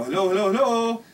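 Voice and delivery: repeated hellos rising in pitch; re-enacted searching call. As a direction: call through a bedroom door. Knocking and calling into bedroom.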